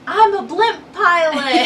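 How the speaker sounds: high, loud, laughing boast; excited. Retold or imitated. Dean bragging while flying the blimp, giddy laughing boast. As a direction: crow it gleefully like a kid. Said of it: "he was like".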